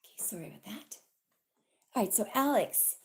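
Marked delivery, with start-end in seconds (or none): 0.0-1.0 whispered off-mic apology; breathy aside, barely voiced; whispering. Real, not acted. Aside after interruption.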